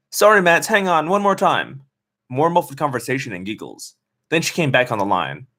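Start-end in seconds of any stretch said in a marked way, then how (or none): 0.1-1.8 distracted friend.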